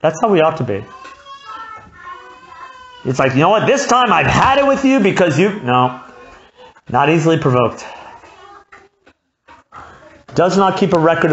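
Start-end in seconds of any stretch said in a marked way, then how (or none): 3.3-5.6 exasperated outburst. Retold or imitated. Preacher acts an exasperated person.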